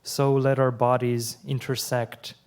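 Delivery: measured, deliberate poem reading with pauses between lines; steady poetry-reading voice. Acted. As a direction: read each line as a poem, letting it land. Reads last poem aloud.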